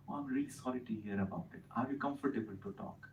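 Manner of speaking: soft, sincere sorry, voice dropped low and quiet; mean the apology, gently and softly; said with feeling. Shown as a demonstration. Doctor gently sympathising with a patient in pain, softly. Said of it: "say it with feeling".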